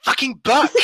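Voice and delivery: "book" said the American way, high and snide; mock mean-girl correction on "book". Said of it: "one of the mean girl characters".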